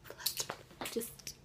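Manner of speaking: breathy sighing whisper, worn out; real flustered whisper; whispered flustered sighs. Real, not acted. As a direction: whisper it with a sigh, frazzled. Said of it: "I'm flustered".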